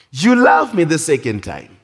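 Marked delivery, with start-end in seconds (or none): 0.1-0.8 high-pitched repeated question, lightly acted; insistent questioning. Jesus asks Peter a second time, lightly acted insistence. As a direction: press him for an answer.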